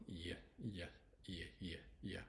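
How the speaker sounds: "yeah" as a short yuh glide; Y sound. Shown as a demonstration. Teacher drilling the Y in yellow, isolated glide. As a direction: repeat "yeah" separately as the bare Y sound. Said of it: "Y can be y, y, y".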